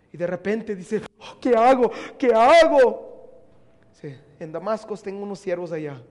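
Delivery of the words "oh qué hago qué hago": loud, desperate cry of what do I do; loud, drawn-out cry of panic. Acted. Saul panics, struck by light.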